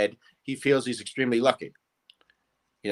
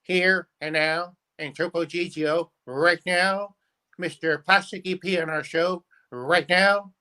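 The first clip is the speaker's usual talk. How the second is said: old variety-show host impression, pitched up; Ed Sullivan; 6 semitones higher, slower than usual. Shown as a demonstration. Impressionist introducing his host on request, as Ed Sullivan. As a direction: introduce the acts in higher, halting showman style. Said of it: "let's do Ed".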